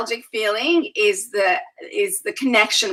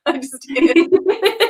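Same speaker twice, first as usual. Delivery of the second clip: laughed through, slow and higher; laughing it off; 8 semitones higher, 4 dB louder, much slower than usual. Retold or imitated. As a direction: shrug it off with a laugh. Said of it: "I'm like".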